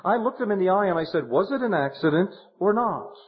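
a hint of the widow quoting the elders; widow's voice. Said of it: "She said".

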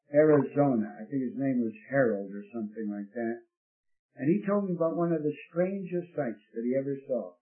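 normal talking voice

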